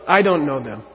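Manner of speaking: ordinary talk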